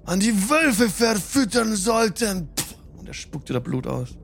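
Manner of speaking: höhere, gebrochene Stimme eines Verletzten; geschundener Gefangener. Acted. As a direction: sprich schwach, unter Schmerzen. Spielleiter spricht als gefesselter Udo. (verletzter Gefangener, mit brüchiger Stimme)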